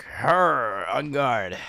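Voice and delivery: hint of a cartoon monster sniffing out prey. Monster spotted by the cat, mock monster voice. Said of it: "The monster is like".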